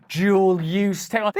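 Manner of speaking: ordinary talk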